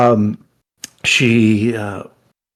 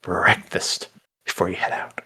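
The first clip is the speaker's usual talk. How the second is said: whispered aside; 4 semitones lower, 6 dB quieter than usual. Acted.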